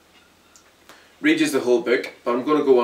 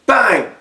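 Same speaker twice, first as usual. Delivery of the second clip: loud, high shouted bang; vocal gunshot, sharp and loud; 11 semitones higher, 12 dB louder than usual. Acted. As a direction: punch it out like a gunshot. Gunshot in kneecapping scene.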